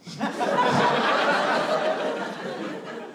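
voiceless laugh; breathy laugh. Real, not acted. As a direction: laugh under your breath. Laugh alone on line.

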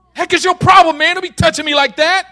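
loud, high confrontation; angry. Acted. Ron touched on his wounded thigh, angry. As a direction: snap at him at full volume. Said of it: "if you touch Ron on the left thigh".

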